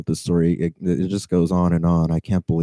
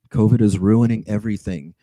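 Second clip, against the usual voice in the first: normal talking voice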